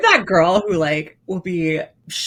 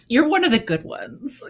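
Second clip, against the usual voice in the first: condescending; 8 semitones higher than usual. Retold or imitated. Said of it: "You know how people say that".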